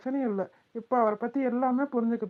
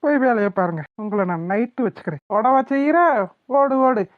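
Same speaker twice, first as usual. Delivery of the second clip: playful threat; 2 semitones higher, 9 dB louder than usual. Acted. Husband playfully threatening wife.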